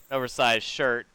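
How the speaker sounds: usual speaking voice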